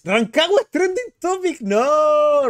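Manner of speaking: high-pitched burst of disbelief; astonished outcry. Real, not acted. Streamer spotting Rancagua as trending topic, astonished outcry. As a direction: blurt it out in disbelief.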